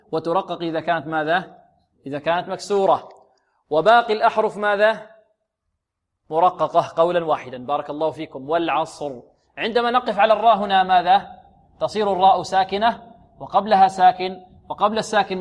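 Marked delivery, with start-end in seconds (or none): none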